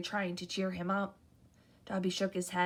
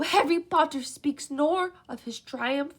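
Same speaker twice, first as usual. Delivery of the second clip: eager house elf; 6 semitones higher, 6 dB louder than usual. A narrator's reading. House elf meeting Harry, high and eager. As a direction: gush like an awed little elf.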